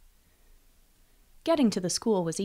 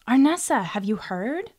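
excited; 5 semitones higher, 4 dB louder, slower than usual. A narrator's reading. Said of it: "she excitedly giggled".

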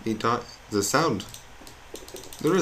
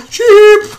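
very high, loud squeal; sudden high-pitched yell; excited shout; 19 dB louder than usual. Real, not acted. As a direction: shriek it out on sighting the prize. Spots a sheep in the game.

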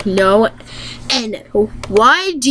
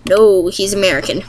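ordinary talk

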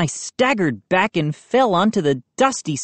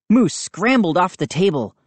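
ordinary delivery

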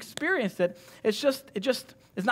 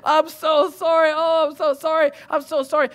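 high, loud panicked soothing; loud mock-frantic apologising, slowing on the sorrys; frantic apology; 8 semitones higher, 7 dB louder than usual. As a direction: act out a flustered parent over a crying baby. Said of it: "And I'm frantic".

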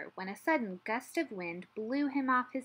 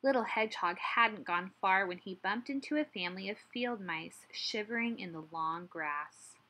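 ordinary delivery, nothing marked

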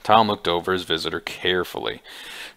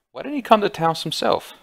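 a sharply higher, insistent demand; 11 semitones higher than usual. A narrator's reading. Bud demands to know why Flambeau stayed away, sharply higher. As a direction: press him hard for an answer, pitched up. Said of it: "Bud demanded".

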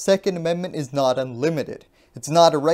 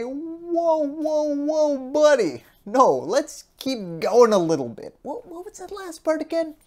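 higher, hammed-up voice slowing on buddy; a put-on, higher voice for the other side; mock conservative voice; 9 semitones higher, 4 dB louder than usual. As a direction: play a smug conservative reining someone in. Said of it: "conservatives are gonna say".

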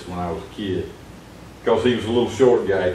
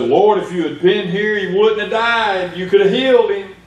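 high pleading lament; grieving sister; 10 semitones higher, 3 dB louder than usual. Acted. Grieving sister pleads with Jesus, high and pleading. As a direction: plead through grief, voice lifted high.

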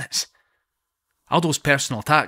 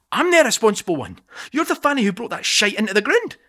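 indignant retort; 9 semitones higher than usual. Acted. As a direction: throw the accusation back. Indignant comeback at Aldo.

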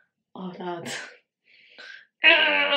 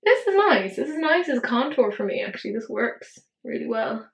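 ordinary talk

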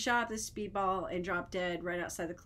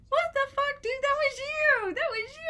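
high, loud yell of disbelief; shrill-pitched, shouted accusation; incredulous outburst; 6 dB louder than usual. Retold or imitated. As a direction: reenact yelling accusingly at the TV. Acted calling out the faker.

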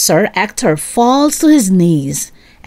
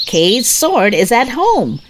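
plain reading voice

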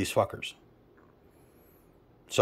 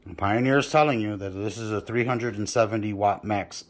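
normal talking voice

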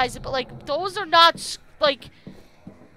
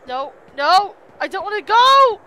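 rising panicked no no, louder and higher; panicked yelling; 7 semitones higher, 11 dB louder than usual. Real, not acted. Gamer yelling at the game not to be dragged away. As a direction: plead with the game in real panic.

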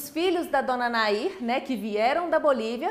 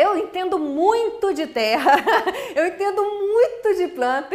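laughing; 5 semitones higher, 6 dB louder than usual. Real, not acted. Brincando que entende de plantas.

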